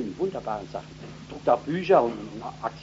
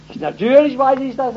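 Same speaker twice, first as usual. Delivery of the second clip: high-pitched, loud retort of an offended mother; indignant mother; 13 semitones higher, 11 dB louder than usual. Retold or imitated. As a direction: play the mother, taken aback and indignant. (empörte Mutter, hoch und laut)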